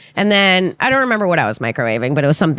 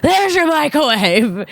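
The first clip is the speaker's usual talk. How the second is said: taunting sneer; 9 semitones higher, slower than usual. Retold or imitated. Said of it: "I go, out of breath".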